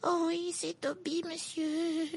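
very high, soft, slow squeak; squeaky elf voice. A narrator's reading. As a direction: squeak it happily as a tiny elf. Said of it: "couina la petite voix".

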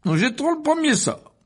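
high, loud, self-satisfied lecturer voice; pompous wizard boasting to the hall; vain showman professor. A narrator's reading. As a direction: preen before the students. Lockhart before the duel.

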